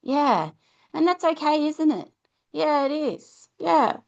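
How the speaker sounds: put-on tender parent reassuring a kid; soothing coaxing. Shown as a demonstration. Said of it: "it does take a while".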